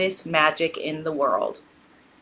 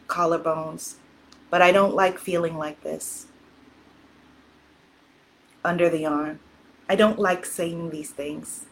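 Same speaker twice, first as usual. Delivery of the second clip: guided tapping script; 6 semitones lower, 4 dB quieter than usual. Acted. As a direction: lead the listener gently through each tapping point. Leads EFT tapping affirmations.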